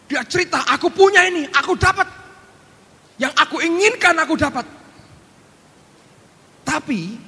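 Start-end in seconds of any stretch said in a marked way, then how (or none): 0.7-4.7 put-on proud child. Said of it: "dia begitu bangga".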